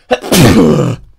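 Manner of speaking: sneeze. Real, not acted. Sneezing fit while gaming.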